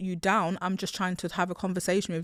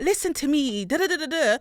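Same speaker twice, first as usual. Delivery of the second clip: mock shouting; 5 semitones higher than usual. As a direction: imitate yourself shouting at someone. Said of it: "Even if I'm shouting".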